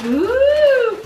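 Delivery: loud, high, drawn-out ooh; impressed ooh. Real, not acted. Mum admiring her present, big impressed ooh. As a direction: swoop up high on a long "ooh".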